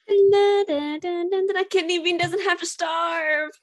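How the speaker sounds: high, giddy fanfare; celebrating. Acted. Character celebrating their dog won't starve, high and giddy.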